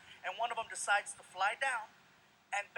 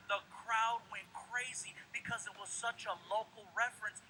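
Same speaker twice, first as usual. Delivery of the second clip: normal talking voice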